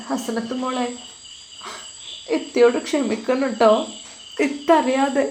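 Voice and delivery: a grieving sister-in-law gently asks forgiveness; tearful apology. A narrator's reading. Young woman apologising to her sister-in-law, softly tearful. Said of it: "ഒന്നുകൂടി തേങ്ങി കരഞ്ഞു".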